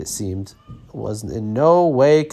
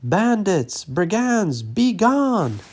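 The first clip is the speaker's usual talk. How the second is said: high yell to drive off bandits; shouting at thieves; 8 semitones higher, slower than usual. A narrator's reading. Orchard owner driving off raccoons, high yell. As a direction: cry them off the property, pitched high.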